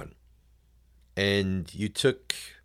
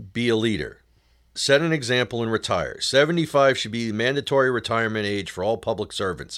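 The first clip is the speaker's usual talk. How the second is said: usual speaking voice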